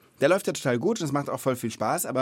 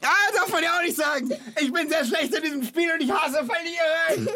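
hammed-up whining. Loses the yes-no game.

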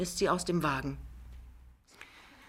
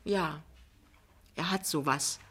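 softer, halting reply; hesitant; 4 dB quieter than usual. A narrator's reading. Lämmchen reluctantly answers her mother-in-law, hesitant. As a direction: answer reluctantly, a little under the voice. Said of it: "sagt Lämmchen zögernd".

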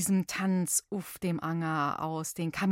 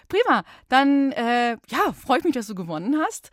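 excited; 4 semitones higher, 8 dB louder than usual. Real, not acted. Praising winning caller.

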